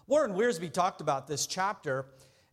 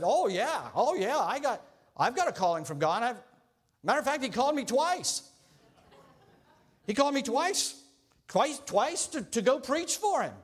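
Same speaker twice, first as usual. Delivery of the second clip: higher, eager bragging candidate; boastful Jonah; 6 semitones higher than usual. Acted. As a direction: brag about being called twice. Committee interview, Jonah boasting.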